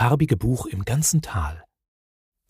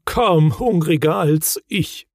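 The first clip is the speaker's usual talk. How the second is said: slow, slightly raised, longing tone; wistful; 5 semitones higher, 3 dB louder, slower than usual. A narrator's reading. Moomin father, hungry in the snow, mildly wistful. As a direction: draw it out with a touch of yearning. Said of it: "sagte der Muminvater sehnsüchtig".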